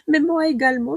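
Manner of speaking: giggling through the words; laughing. Real, not acted. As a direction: laugh while saying it. Laughs at herself.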